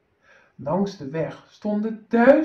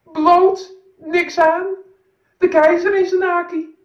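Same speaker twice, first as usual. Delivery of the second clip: much higher, loud mocking shouts; jeering crowd; 9 semitones higher, 8 dB louder than usual. Crowd jeers at the naked emperor, loud and high. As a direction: jeer at the emperor. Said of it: "begon te krijsen, te schreeuwen".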